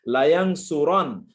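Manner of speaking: লায়াংসুরান, a loud slow model for the class. Shown as a demonstration. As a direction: spell out লায়াংসুরান slowly, ending with a clear -রান. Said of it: "কি উচ্চারণ করবেন".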